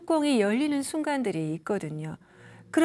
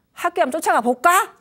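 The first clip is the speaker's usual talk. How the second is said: higher, louder, teasing eager offer; playful offer; 6 semitones higher, 8 dB louder than usual. Shown as a demonstration. Parent siding with child, playfully offering to storm the school. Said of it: "살짝".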